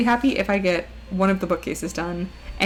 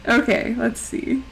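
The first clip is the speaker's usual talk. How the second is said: ordinary talk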